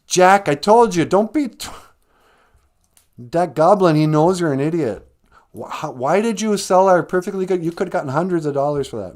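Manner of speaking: loud, high acted telling-off; exasperated scolding mom. Mom berates Jack for selling the cow, loud exasperation.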